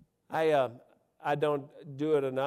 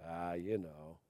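mock modest shrug; 7 semitones lower, 8 dB quieter, slower than usual. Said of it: "And go".

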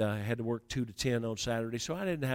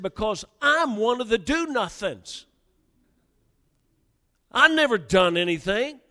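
loud, high-pitched shouted confession; impassioned outburst; 9 semitones higher, 9 dB louder than usual. Retold or imitated. New leader confessing to his adviser, loud and worked up. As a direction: shout it out as the argument's climax. Heated confession at argument's climax.

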